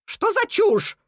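very high-pitched indignant cry; exclaiming. A narrator's reading. Cuckoo dismissing the rabbit's warning, high exclamation. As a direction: exclaim it in disbelief. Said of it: "воскликнула Кука".

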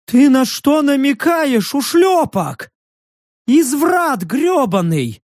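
high, slow outraged beast voice; high offended creature. A narrator's reading. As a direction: bristle like an insulted furry freak. Creature insulted.

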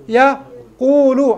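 "يقول" pulled apart as ya... quulu; very slow "يقول" with a gap after ya; syllable breakdown. Shown as a demonstration. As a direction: stretch "يقول" into ya, QUU-lu for weighing. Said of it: "على وزن ماذا".

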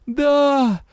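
high hoarse cry; hoarse shout. A narrator's reading. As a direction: rasp it out. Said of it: "Мой хриплый крик".

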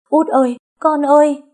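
high, loud cry of relief; tearful joyful cry. A narrator's reading. Parents crying out to their revived daughter, joyful wail. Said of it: "mừng tới khóc".

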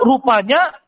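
ordinary delivery

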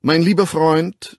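loud, high stern address; stern, carrying tone. A narrator's reading. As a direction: rebuke him from above. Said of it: "in strengem, tragendem Ton".

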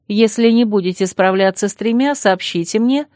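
ordinary delivery

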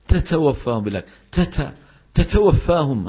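"تتوفاهم" with a puff of air on each ta; over-aspirated ta. Shown as a demonstration. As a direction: blow the ta in "تتوفاهم" too hard. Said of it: "هذه زايد عن اللزوم". (مبالغة في همس التاء)

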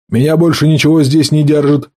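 ordinary delivery

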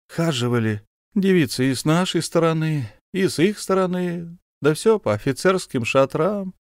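high, easy reminiscing; lightly voiced chatty officer. Officer reminisces to the count, high and easy. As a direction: blurt out the old war tales. Officer recalls war, babbling.

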